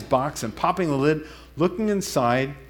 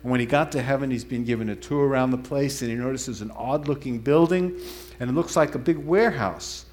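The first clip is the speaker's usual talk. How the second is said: normal talking voice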